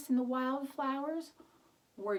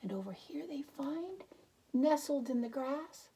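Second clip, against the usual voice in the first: plain reading voice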